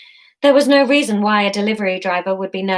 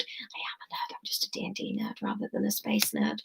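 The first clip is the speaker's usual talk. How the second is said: barely audible whisper; whispered confession; 15 dB quieter than usual. Real, not acted. Reader admitting to being a nerd, whispered. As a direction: drop right down to a whisper.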